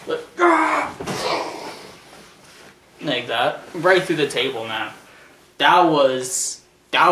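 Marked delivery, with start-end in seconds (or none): 0.4-1.7 high imitated impact cry; crash yell. Acting out Alberto's fall through the table, high yell. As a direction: cry out like a body hitting a table.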